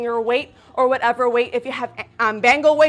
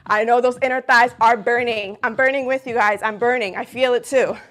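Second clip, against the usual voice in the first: usual speaking voice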